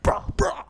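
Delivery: loud, high mock bellows; manly bluster noises. Two men squaring up, mock manly bellowing. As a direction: burst out two macho barks. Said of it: "this real manly, like".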